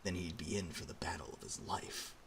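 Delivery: ordinary delivery, nothing marked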